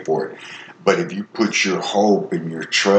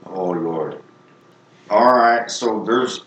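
ordinary talk

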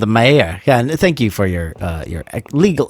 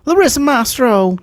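high silly voice; 10 semitones higher than usual. Pretend. Silly voiced patron names.

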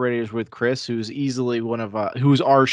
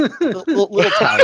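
laughing; 11 semitones higher, 6 dB louder than usual. Real, not acted. Laughing through the words.

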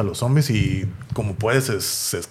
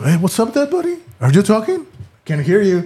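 high, sing-song jeering at a dying man; retold mockery, high and teasing; mocking taunt; 10 semitones higher than usual. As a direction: taunt him like a smug bully, pitched way up. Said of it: "Negan todavía burlando".

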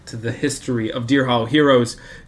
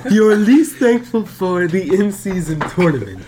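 put-on laughing through the accent; 5 semitones higher, 4 dB louder than usual. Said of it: "please drop the accent".

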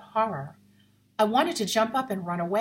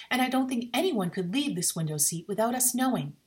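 ordinary delivery, nothing marked

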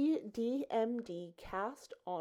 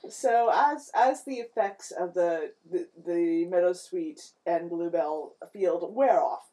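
louder scene-setting narrator voice; game master narration; 3 semitones lower, 6 dB louder than usual. Acted. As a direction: set the scene for the party.

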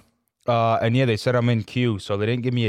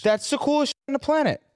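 much higher, thrilled exclamation; excited; 13 semitones higher than usual. Real, not acted. Showing the matte truck photo, high excited rave. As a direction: rave about it, pitch way up.

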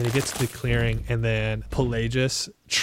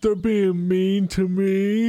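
higher, slow, sulky ogre voice; whiny ogre; 8 semitones higher, slower than usual. Acted. Ogre complaining to the party about bullies, whiny. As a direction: whine it like a hurt giant.

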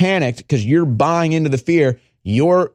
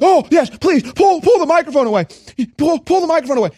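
mock eager voice; 9 semitones higher than usual. Mocking the eager reporter, high gushing plea. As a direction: gush it in a high squeal. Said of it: "She's like".

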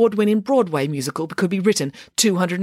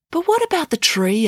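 put-on curious child; 13 semitones higher, 3 dB louder than usual. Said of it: "asked Michael".

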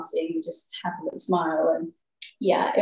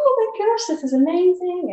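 high, loud burst of delight; acted-out overjoyed squeal; excited; 11 semitones higher, 4 dB louder than usual. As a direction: re-enact thrilled disbelief, voice soaring. Acting out their joy at contract.

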